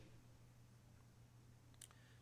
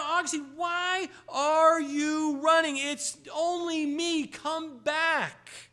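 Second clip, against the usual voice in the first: high, louder slow pleading call; 8 semitones higher, 7 dB louder, slower than usual. Retold or imitated. Woman calls Augustine back, high pleading call. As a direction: call him back, drawing the words out. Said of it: "she called out".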